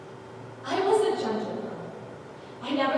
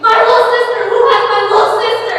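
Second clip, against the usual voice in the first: frantic fast scream, much higher and louder; 9 semitones higher, 14 dB louder, faster than usual. A narrator's reading. Narrator searching the mob for her sister, frantic scream. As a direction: beg the crowd in panic. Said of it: "I ran towards the mob screaming".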